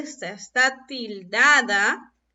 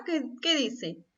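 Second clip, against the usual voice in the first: normal talking voice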